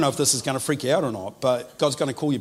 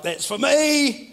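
high, slow, drawn-out inner exclamation; recognizing inner voice; 11 semitones higher, 5 dB louder, much slower than usual. Retold or imitated. Preacher voicing a churchgoer who recognizes a word: high slow exclamation. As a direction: voice the listener's delighted realization slowly. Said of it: "and whoever feels like".

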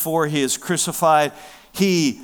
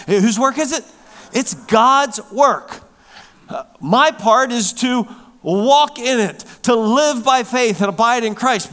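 louder, higher, driving the point home; full-force preaching; 8 semitones higher, 7 dB louder than usual. Real, not acted. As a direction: preach it out to the congregation.

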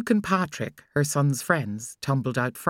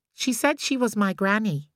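put-on higher little boy voice, shrugging; child's voice; 6 semitones higher than usual. A narrator's reading. Boy telling his mother about the lady, childlike. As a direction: say it plainly like a child.

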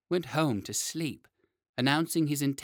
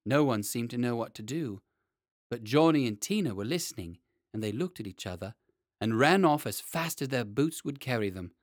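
ordinary delivery

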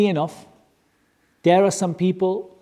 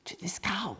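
whisper; 15 dB quieter than usual. Real, not acted. Storytelling about bowing to cow.

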